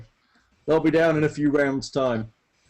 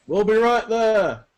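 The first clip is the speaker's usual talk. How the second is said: high, slow, loud distant call; calling back; 7 semitones higher, 5 dB louder, slower than usual. Acted. Workers on the scaffolding answering below, calling back. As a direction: holler down from high up.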